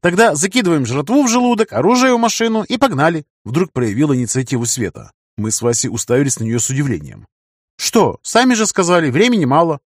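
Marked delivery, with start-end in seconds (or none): 0.0-3.3 put-on lightly raised girl's voice; young woman voice. A narrator's reading. Narrator voices Света. 7.8-9.8 put-on lightly raised girl's voice; young woman voice. A narrator's reading. Narrator voices Света.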